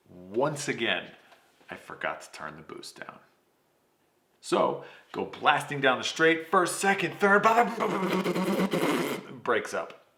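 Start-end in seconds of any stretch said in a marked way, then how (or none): 4.4-9.3 rising higher and louder with each gear; engine revving rush. Retold or imitated. Driver reliving the launch, rising excited gear call. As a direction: call out the gears like the car is screaming.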